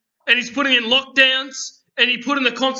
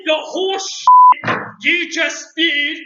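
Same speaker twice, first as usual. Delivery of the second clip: higher, louder, slow hammed-up anger; mock outrage; 5 semitones higher, 6 dB louder, much slower than usual. Host calling out a guest's nonsense, slow mock outrage. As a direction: drag it out in mock disgust. Heated podcast argument, calling out.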